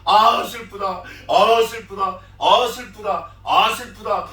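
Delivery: loud mock lament, leaning on each opening cry; demonstrated wailing. Shown as a demonstration. Preacher showing how mourners should cry, loud mock wailing. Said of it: "이렇게 하고 울어야 되는데".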